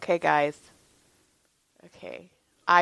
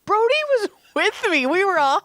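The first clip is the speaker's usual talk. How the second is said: high, worked-up retelling; pitched well up in laughing disbelief; horrified exasperation; 11 semitones higher than usual. Retold or imitated. Horrified retelling of the cheery wave.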